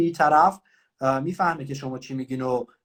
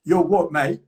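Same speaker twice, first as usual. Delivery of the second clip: British cop; 6 dB louder, much slower than usual. Retold or imitated. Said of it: "پلیس گفت".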